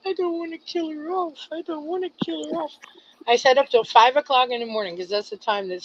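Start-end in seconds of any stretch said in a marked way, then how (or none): 0.1-1.5 high, quiet pleading as if near tears; tearful plea. Retold or imitated. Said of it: "And I'm sitting there and I'm crying". 1.5-2.8 high, soft and fast, rushing the plea again; hurried tearful plea. Retold or imitated. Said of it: "And I'm sitting there and I'm crying".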